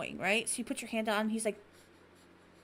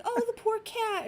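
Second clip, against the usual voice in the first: mock pitying kids; 11 semitones higher, slower than usual. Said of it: "the kids are like".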